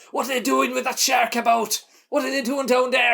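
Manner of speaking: high-pitched mock holler; Richard Harris. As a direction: imitate an actor calling out in outrage. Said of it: "Richard Harris shouts".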